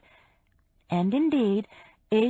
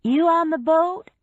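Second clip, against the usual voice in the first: raised, loud call with a lifting question; 9 semitones higher, 6 dB louder than usual. A narrator's reading. Woman calling to her husband on the boat, calling out. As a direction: call it down the pier, pitched up. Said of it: "Laura called, striding down the pier".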